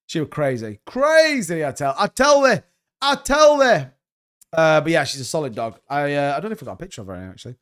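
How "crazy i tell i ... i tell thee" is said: mock Yorkshire. Mock Yorkshire dialect about dog.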